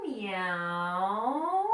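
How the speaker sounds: long drawn-out meow, rising at the end; stretched-out cat call. Pretend. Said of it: "what does a cat say?".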